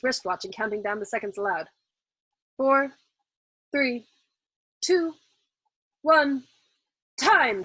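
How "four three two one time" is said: loud countdown, pitch climbing; called out. A narrator's reading. Officer counting down seconds aloud, called out loud. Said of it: "called out Major Danby".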